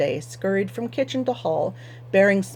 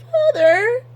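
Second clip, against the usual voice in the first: much higher, pleading daughter voice; protesting daughter; 7 dB louder than usual. Acted. Daughter protests to her stubborn father, much higher. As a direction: protest in a high whiny daughter's voice. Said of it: "Anhelda protested".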